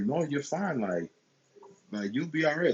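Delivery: lightly voiced lady brushing off his worry; imitating older woman. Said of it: "And she's just like".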